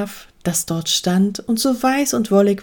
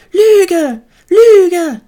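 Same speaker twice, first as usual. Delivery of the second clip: high, loud repeated cry; accusing shouts; 10 semitones higher, 8 dB louder than usual. A narrator's reading. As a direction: hurl the accusation at top pitch. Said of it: "riefen die zwei neuen Schafe".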